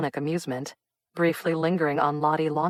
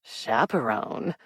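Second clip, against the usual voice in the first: skeptical echo; 4 semitones lower, much slower than usual. A narrator's reading. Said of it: "Raymond repeated, brow raised".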